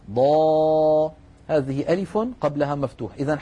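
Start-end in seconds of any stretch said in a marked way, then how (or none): 0.1-1.1 ضا held out long and louder; long madd. Shown as a demonstration. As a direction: stretch the vowel of ضا fully. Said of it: "هل رأيتم هذه الألف". (مد ألف ضا مدا طويلا)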